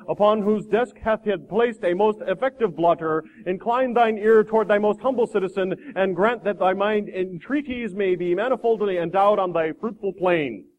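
slow, grandiose liturgical oration; pompous supplicant. Acted. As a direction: intone it like a florid prayer. Pompous man prays archaically to president.

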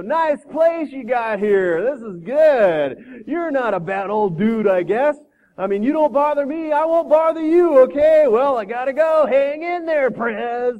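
loud, high, stretched-out slacker talk; breezy young dude. Acted. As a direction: holler casually over your headphones. Young dude chats breezily at president.

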